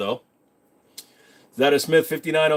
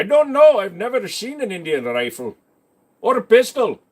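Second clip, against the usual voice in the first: put-on Indian accent, higher, drifting Scottish; 7 semitones higher, 3 dB louder than usual. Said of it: "your Indian accent turned out almost Scottish".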